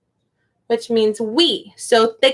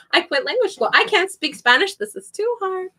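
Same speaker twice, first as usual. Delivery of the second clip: higher-pitched mock whine of defeat; mock giving up; 8 semitones higher than usual. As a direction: playfully overdo being overwhelmed. Retold overwhelmed reaction.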